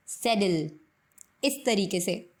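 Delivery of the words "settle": "सैडल" with a d-like t; flapped t "settle". Shown as a demonstration. Teacher showing the native settle. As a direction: say "settle" as "seddle". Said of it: "सेटल को बोलेंगे सैडल".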